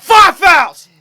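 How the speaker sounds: high, loud exclamation; mock outraged yell. Player re-enacting his halftime speech, mock outraged yell. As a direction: yell it like scolding slackers, hammed up.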